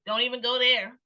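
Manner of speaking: normal talking voice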